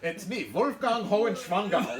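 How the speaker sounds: put-on loud, high Austrian-accent caricature; Arnold Schwarzenegger. Film fan inventing an accent-matching Arnold name, hammed-up Austrian. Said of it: "a name that matches the accent".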